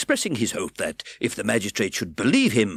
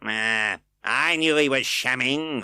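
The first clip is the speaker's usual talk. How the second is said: scornful; 5 semitones higher, 5 dB louder, slower than usual. A narrator's reading. Fang scornful.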